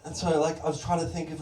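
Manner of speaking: normal talking voice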